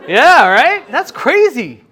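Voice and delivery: loud, high incredulous outburst; mock disbelief. Preacher reacting to his controversial idea, exaggerated disbelief. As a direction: cry it out high, as if astounded. Acted disbelief, joking with congregation.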